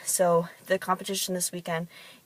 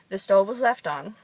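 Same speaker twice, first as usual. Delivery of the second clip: ordinary talk, nothing marked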